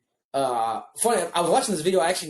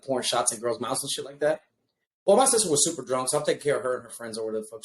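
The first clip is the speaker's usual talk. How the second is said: ordinary delivery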